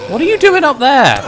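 high, loud disbelief; indignant shout. Real, not acted. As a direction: yell it up at the enemy. Spots enemy in game.

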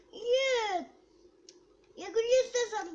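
high, loud whining cry; whiny protest. Real, not acted. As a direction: protest in a tearful whine. Kid upset protesting about car.

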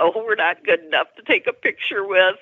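high, whiny pretend sob; mock fake crying. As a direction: imitate the band's mock-wounded fake cry. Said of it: "they started to fake cry".

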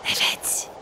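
hushed whisper. Pretend. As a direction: breathe it without voice. Whispered aside while playing.

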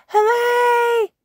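put-on very high, loud toddler cheer. A narrator's reading. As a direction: cheer with joy. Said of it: "George cheers".

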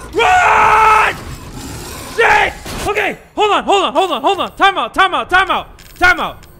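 loud, high frantic cries; panicked shouting. Real, not acted. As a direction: beg for a pause while fleeing. Panicked fleeing from boss.

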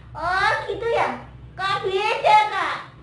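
loud, high surprised puppet; Momon. Pretend. Momon questions Kak about the prayer, surprised puppet voice.

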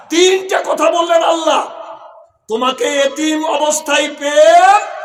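high, forceful preacher's call; loud proclamation. Real, not acted. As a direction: proclaim it loudly to the whole crowd. শ্রোতাদের উদ্দেশে জোরালো ঘোষণা. (উচ্চকণ্ঠে ঘোষণা)

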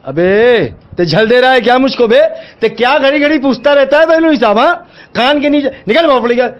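angry dismissal. Real, not acted. Shopkeeper has had enough and threatens to report him, angry. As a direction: order the pest out, fed up.

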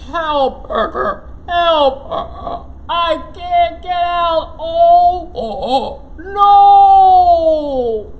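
frantic croaky cries, ending in a loud shout; frog calling for help. Acted. Trapped frog shouting up for help. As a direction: panic at the bottom of a hole. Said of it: "Croaked Frog".

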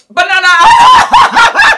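shrieks it out through a big laugh, high and loud; laughing shout. Real, not acted. To her viewers, revealing his secret spot with a laughing shout. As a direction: stretch it slowly and howl with laughter. Gossiping about husband's girlfriends.

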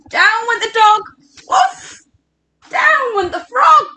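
high, urgent picture-book narration; animated reading to children, pitched high; dramatic story reading. Acted. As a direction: call out each fall in mock alarm. Reads the fall to children.

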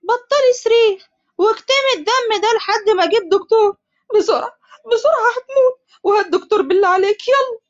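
high frantic shouting with tears; صراخ; screaming. Acted. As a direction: yell orders in panic. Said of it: "عمر وحمزة بصريخ".

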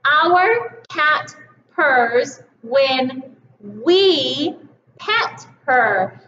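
very slow, leaving room on we; slow reading. Shown as a demonstration. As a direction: read slowly for the kids to join. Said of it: "you read we with me".

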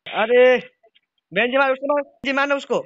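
loud, high frantic yelling; excited shout. Real, not acted. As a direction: scream for them to shoot now. Excited yell during firefight. (उत्तेजित होकर ज़ोर से चिल्लाना)

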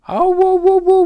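put-on very high, loud child's wail; boy's cry. Little boy crying out at the duck, high and loud. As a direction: cry out like a little boy snatching back his cake. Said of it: "cried the little boy".

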